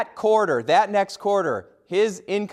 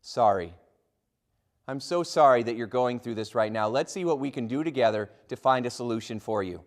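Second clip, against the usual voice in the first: sincere apology; 6 semitones lower, 3 dB quieter than usual. Shown as a demonstration. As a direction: lower and gentle, sorry for their trouble. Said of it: "by saying one word".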